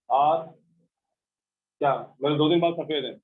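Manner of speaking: ordinary delivery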